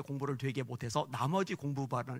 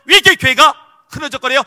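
even higher and louder rhetorical question pressing on 왜; fiery preaching; 10 semitones higher, 21 dB louder than usual. Acted. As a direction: push 왜 harder, building the outcry. Preaching at full force.

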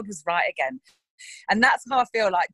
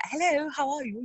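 higher, put-on telephone voice; phone voice; 6 semitones higher, 4 dB quieter than usual. People answering the phone with hello, in a higher phone voice. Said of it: "we all have a phone voice".

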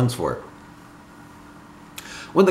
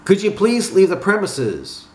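higher-pitched polite appeal; polite request; 8 semitones higher than usual. A narrator's reading. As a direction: ask him firmly but politely to go. Polite request acted in story.

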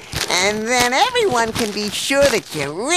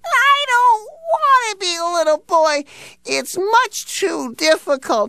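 crying; 7 semitones higher than usual. Acted. Dog gives up being a boy.

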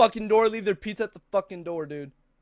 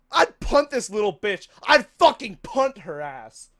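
normal talking voice

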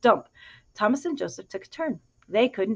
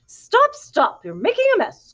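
much higher and louder squawk; hen crying out; 12 semitones higher, 5 dB louder than usual. A narrator's reading. First hen yells at the pigs spoiling the fence, high and loud. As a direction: shriek to stop them like a flustered hen. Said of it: "cried the first hen".

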